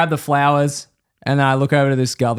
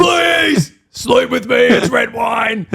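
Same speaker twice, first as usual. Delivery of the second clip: much higher, shouted, slow at end; mock shouted plea; 11 semitones higher, much slower than usual. Mocking the Italian guy's advice, hammed-up shouted begging.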